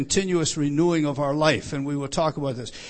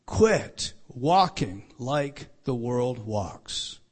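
very slow, lower, word-by-word command; emphatic; 5 semitones lower, much slower than usual. Retold or imitated. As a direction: space out every word for weight. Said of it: "What is the emphatic statement?".

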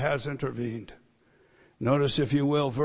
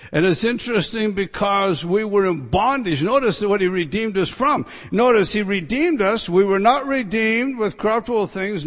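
raised, loud pulpit delivery; impassioned preaching; 6 semitones higher, 7 dB louder than usual. Real, not acted. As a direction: press each point on the congregation.

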